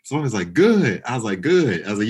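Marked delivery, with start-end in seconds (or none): 0.5-1.0 "Good" punched high and loud, falling; high, punchy "Good" dropping at the end; emphasized good. Retold or imitated. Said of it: "it's just emphasizing good". 1.4-1.9 "Good" thrown back high, falling; higher-pitched, emphatic "Good"; emphasized good. Retold or imitated. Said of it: "it's just emphasizing good".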